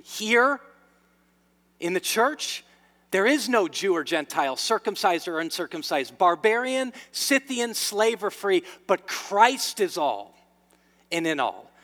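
loud, declaimed Bible verse; emphatic scripture preaching. Acted. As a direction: proclaim each item of the list forcefully. Declaims scripture at full force.

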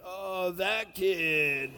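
very drawn-out, slow kid imitation; comic slow little-kid voice. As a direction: stretch it like a five-year-old groping for a name. Five-year-olds forgetting teammates' names.

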